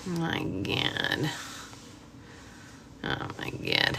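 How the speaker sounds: breathless whisper; whispered dismay; whispered oh my God. Real, not acted. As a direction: mutter it under your breath in dismay. Embarrassed after filter mishap.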